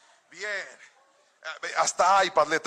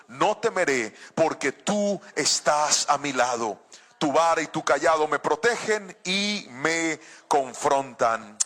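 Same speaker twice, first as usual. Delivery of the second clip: slow, lower-pitched solemn Bible reading; scripture reading; 4 semitones lower, slower than usual. Acted. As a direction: read the psalm deliberately, weighing each phrase. Lee el Salmo en voz solemne. (lectura bíblica solemne y pausada)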